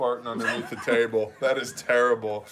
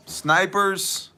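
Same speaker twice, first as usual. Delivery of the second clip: ordinary talk, nothing marked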